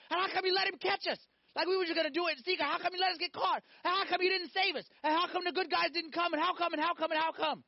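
high-pitched, piled-up protest to God; indignant mock bellyaching, pitched high; mock whining complaint. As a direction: whine it as an aggrieved, endless string of how-comes. Acts the men's imagined complaint.

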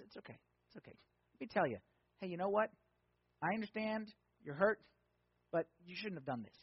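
soft, hushed reassurance; gentle God voice. Acted. Pastor voices God comforting a hurt believer, soft and gentle. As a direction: soothe a hurting child gently. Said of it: "He's loving, he's gentle".

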